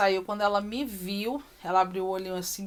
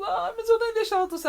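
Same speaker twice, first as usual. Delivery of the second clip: much higher, startled objection; surprised protest; 13 semitones higher, 4 dB louder than usual. Retold or imitated. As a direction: yelp it out in disbelief. Mother surprised by physiotherapist. (mãe surpresa com a fisioterapeuta, protesto bem agudo)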